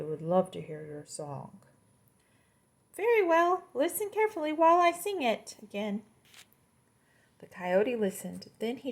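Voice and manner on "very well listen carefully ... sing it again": proud little insect teacher; high locust, patient but grand. Acted. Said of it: "this is the locust talking".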